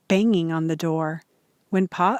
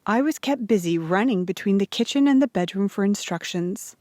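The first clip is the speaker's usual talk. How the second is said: plain reading voice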